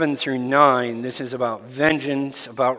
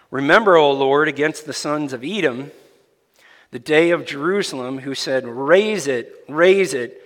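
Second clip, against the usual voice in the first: louder, solemn Bible reading from the pulpit; scripture reading; 2 semitones higher, 5 dB louder than usual. Acted. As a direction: proclaim the psalm to the congregation. Reads scripture aloud.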